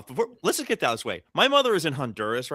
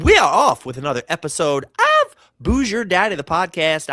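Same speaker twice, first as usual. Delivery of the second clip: hyped intro; 3 semitones higher, 7 dB louder than usual. Acted.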